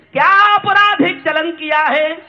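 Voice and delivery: high, loud declaimed question; ringing poetic challenge; impassioned verse. Acted. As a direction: hurl the question at the crowd. Impassioned question in stage poem.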